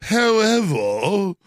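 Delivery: slow, haughty fake British, rising high on regal; mock royal British. Host mocking Gorka's accent, slow fake regal British. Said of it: "the most fake royal, regal".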